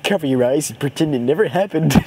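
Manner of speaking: ordinary talk, nothing marked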